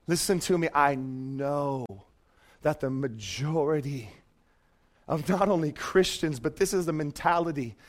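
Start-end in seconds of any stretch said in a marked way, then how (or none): none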